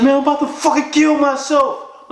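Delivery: very high, anguished retold cry; imitated crying despair. Crying friend on the phone to caller, imitated despair. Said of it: "He was crying".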